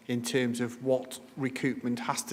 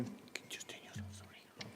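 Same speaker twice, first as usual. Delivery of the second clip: hushed off-mic whisper to someone nearby; whispered aside; 22 dB quieter than usual. Real, not acted. Whispering an aside to a colleague during the meeting, real whisper. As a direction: drop to a whisper to sort something out quietly.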